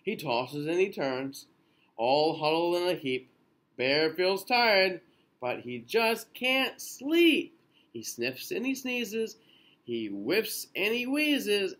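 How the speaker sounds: lilting storybook read-aloud; gentle bedtime picture-book reading; reading to children. A narrator's reading. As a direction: read it slowly to little ones.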